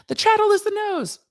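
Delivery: excited squeal. Retold or imitated. Law student blurting the punchline, giddy high squeal. Reenacting law school joke.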